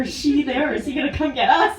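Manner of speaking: mock-frantic friends; excited quoted questions. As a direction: rattle the questions off, worked up. Friends spot the returning van.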